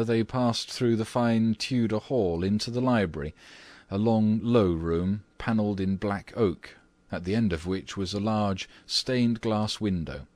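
ordinary delivery, nothing marked

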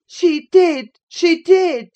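high, loud, gloating chant; singsong taunt. A narrator's reading. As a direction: chant it to needle your cousin. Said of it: "sang Linton".

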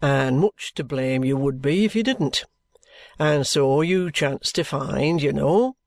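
a hint of a low, knowing police inspector; measured, confiding lawman's voice; lightly voiced detective. A narrator's reading. Detective Bucket explaining the case to Mr Jarndyce, knowing and measured.

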